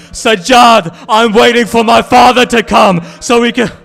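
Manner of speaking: very loud, high-pitched mourning sermon; impassioned lament preaching. Acted. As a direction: wail out the tragedy at full force. Preacher recites tragedy at full force.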